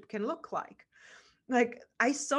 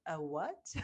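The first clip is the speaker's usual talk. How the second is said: puzzled mock; 6 semitones lower, 7 dB quieter than usual. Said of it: "because people are like".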